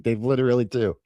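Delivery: ordinary delivery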